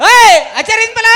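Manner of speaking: loud, high holler; big impatient call; shouted order. Real, not acted. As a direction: shout the instruction across the stage. Shouts at helper on stage.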